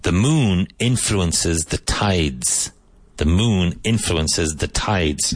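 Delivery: very slow, deliberate English translation; slow translation read. Shown as a demonstration. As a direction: give the translation slowly so students can follow. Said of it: "Vaughan Translation Booklet seven".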